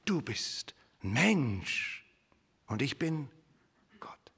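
slow and grave, fading away on "Gott"; solemn declaration. Acted. God telling Moses he is only human, solemn. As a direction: draw the line between man and God. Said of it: "Nein, nein, tritt nicht herzu".

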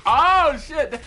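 loud, high-pitched cursing; frustrated outburst. Real, not acted. Gamer cursing the map he keeps dying on, frustrated outburst. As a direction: yell out in exasperation.

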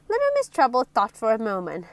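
ordinary delivery, nothing marked

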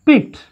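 "picked" with little puff of air on the P; weakly aspirated P. Shown as a demonstration. Teacher models the rule, lightly aspirated P in "picked". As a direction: keep the P of "picked" light, short vowel after it. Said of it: "You have to pronounce it as".

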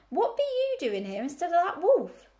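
put-on lightly croaked old ewe, high and loud; croaking. A narrator's reading. Old ewe challenges the pig, lightly croaked high question. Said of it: "she croaked".

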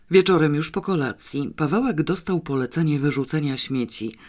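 usual speaking voice

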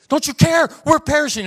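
high, loud, frantic cry of fear; panicked disciples. Acted. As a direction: yell it like men about to drown.

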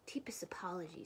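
soft, whispery apology; hushed apology. A narrator's reading. Mouse apologizes to Bear for humming, hushed. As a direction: apologize barely above a whisper. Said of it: "says Mouse".

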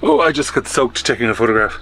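normal talking voice

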